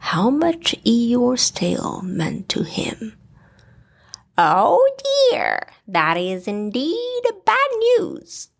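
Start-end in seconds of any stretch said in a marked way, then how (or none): 4.4-8.5 put-on very high, fussy old bird; a high, dismayed hoot of a voice; Owl voice. A narrator's reading. Owl reacting to the bad news, very high and dismayed. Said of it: "replied Owl".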